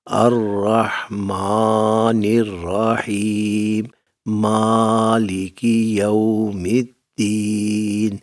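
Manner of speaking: very slow, every vowel stretched long; slow drawn-out recitation. Shown as a demonstration. Teacher showing students the wrong slow recitation, drawn out. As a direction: drag the words out the wrong way. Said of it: "اس طرح نہیں پڑھنا".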